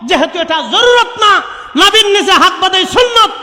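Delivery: high, loud roll call of saints; full-force preaching. Real, not acted. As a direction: proclaim each name to the crowd. (উচ্চস্বরে ওলিদের নাম ঘোষণা)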